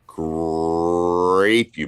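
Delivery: "Grape" with a long rolling "grrr"; drawn-out Grape. Shown as a demonstration. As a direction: stretch the start of "Grape" very slowly. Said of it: "I believe it's grape you".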